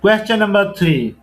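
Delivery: loud, deliberate MCQ recitation; loud slow quiz reading. Acted. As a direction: call out the question and option slowly. Reads MCQ question and answer.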